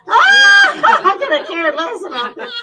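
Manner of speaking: loud, high words through laughter; laughing. Real, not acted. Laughing loudly while telling of meeting Peter Lawford.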